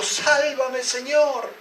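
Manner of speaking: high-pitched cry for rescue; desperate cry. Acted. Preacher voices Peter sinking into the water, high desperate cry. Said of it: "gritó".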